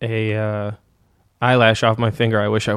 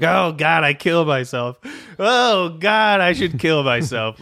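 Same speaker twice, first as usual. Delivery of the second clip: dramatic despair; 5 semitones higher, slower than usual. Acted. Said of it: "and you're like".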